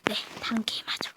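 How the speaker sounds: fast, unvoiced whisper. Real, not acted. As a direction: whisper it quickly to the camera. Whispered aside to viewers.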